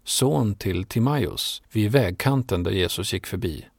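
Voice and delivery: plain reading voice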